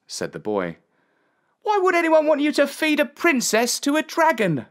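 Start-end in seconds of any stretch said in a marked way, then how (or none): 1.6-4.7 put-on very high-pitched boy's exclamation; shocked boy. A narrator's reading. Boy hears of the princess's fate, high shocked voice. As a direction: gasp it as an astonished lad.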